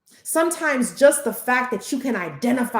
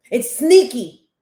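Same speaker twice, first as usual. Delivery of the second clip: "sneaky" punched loud; louder repeat of "sneaky"; 8 dB louder, slower than usual. Shown as a demonstration. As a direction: hit "sneaky" hard and loud. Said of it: "See, it's sneaky".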